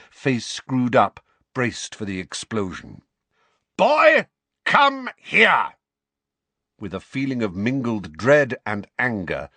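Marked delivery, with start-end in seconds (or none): 3.8-5.7 high, loud furious bellow; angry shout. Acted. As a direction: yell at the boy in rage. Vernon summons Harry furiously.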